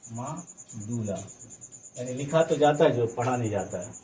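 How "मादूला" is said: "मादूला" drawn out slowly, syllable by syllable, trailing off softer; slow drawn-out term. Shown as a demonstration. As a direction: stretch "मादूला" slowly and let it fade.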